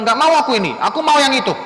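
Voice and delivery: loud, high bratty demand; rude, demanding child. Acted. Child refusing papa's food, loud and rude. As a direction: refuse loudly and insist on the other. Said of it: "Bukan bilang".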